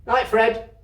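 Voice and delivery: loud friendly call of goodnight. Acted. Storeman calling goodnight to Fred, loud and friendly. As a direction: call it out across the yard.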